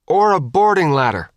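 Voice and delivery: shouting back. A narrator's reading. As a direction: shout on across the deck. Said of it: "Hooch shouted back".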